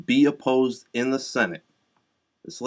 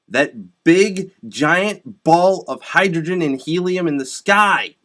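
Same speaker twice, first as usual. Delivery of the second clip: high, loud, emphatic rant; exasperated lecturing; 5 semitones higher, 6 dB louder than usual. Real, not acted. Telling elementary science to adults, loud and exasperated. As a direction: spell it out forcefully for fools.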